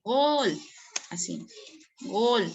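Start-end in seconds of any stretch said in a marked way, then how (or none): 0.0-0.6 long drawn-out gol like a commentator; stretched goal call. Shown as a demonstration. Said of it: "ese alargamiento de la vocal".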